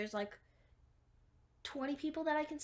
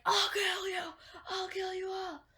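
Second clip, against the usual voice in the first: high, loud mock-raging yell; hammed-up crazed attacker, yelling threats; 8 semitones higher, 5 dB louder than usual.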